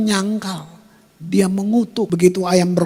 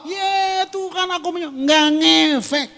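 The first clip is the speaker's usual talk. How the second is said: mock worship shout; 8 semitones higher than usual.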